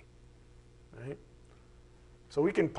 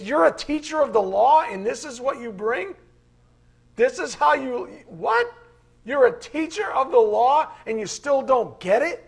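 berating Jesus; 6 semitones higher, 6 dB louder than usual. Retold or imitated. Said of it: "he goes".

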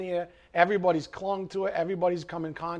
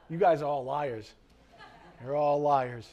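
ordinary talk

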